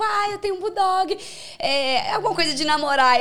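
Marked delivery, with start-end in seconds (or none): 0.0-1.2 lightly acted opener, pitched high; flirty retold message. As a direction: toss it out in a higher voice, as a flirty message. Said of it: "eu mandei no direct alguma coisa". (cantada leve, em voz mais aguda)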